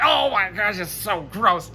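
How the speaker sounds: a hint of a grossed-out heroine; lightly voiced disgust at the muck. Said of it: "And it's like".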